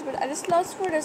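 usual speaking voice

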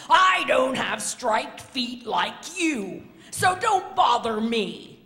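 loud, high, hammed-up scolding; blustering, fed-up walrus; grumpy walrus. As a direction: shout the little bird away. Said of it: "grumped Missus Walrus".

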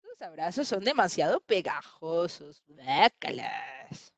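slow, drawn-out yuck; disgusted. Acted. Little leopard grossed out by a sticky hug, disgusted. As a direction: drag out the last word in distaste.

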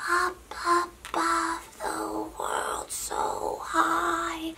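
slow, high, breathy whisper; character voice combination. Shown as a demonstration. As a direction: float it up softly and slowly. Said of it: "Try out different combinations".

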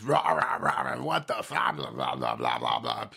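mock bear roar dropping into low babble; bear mode. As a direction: roar like a bear, then sink into low gibberish. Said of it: "I went full-blown bear mode".

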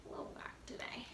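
quick unvoiced whispered aside; whispered aside about her hair. Real, not acted. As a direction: mutter it under your breath.

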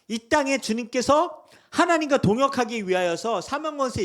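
loud, high, driving sermon; fiery preaching. Real, not acted. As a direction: preach it with full force. Preaching at full force.

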